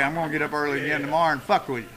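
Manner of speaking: ordinary talk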